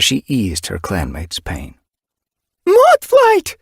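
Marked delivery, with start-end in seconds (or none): 2.7-3.6 high, loud pained cry; pained shriek. A narrator's reading. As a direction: shriek a name in pain. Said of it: "A pained shriek sounded outside".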